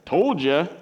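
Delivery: put-on smugness; mock I-told-you-so. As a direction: rub it in, briefly. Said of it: "I really don't think it was this".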